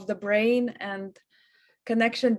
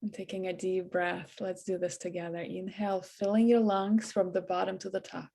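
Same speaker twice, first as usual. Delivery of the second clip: soft, hushed meditation voice with long pauses; guided meditation; 2 semitones lower, 6 dB quieter than usual. Acted. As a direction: ease them into relaxation, quietly and evenly. Leads a guided breathing meditation.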